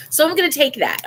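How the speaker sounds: high, loud, pleased; taking the good news, high and pleased; upbeat. Real, not acted. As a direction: claim the small win brightly. Upbeat resolve.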